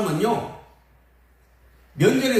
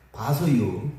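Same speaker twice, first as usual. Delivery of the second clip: low, noncommittal Chungcheong "봐서요"; Chungcheong-style reply; 7 semitones lower, much slower than usual. Retold or imitated. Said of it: "뭐라 그래요?".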